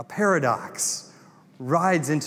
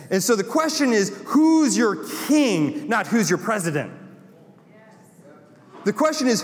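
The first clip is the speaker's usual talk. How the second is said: full-force preaching; 8 semitones higher than usual. Acted. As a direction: preach it out to the congregation, building.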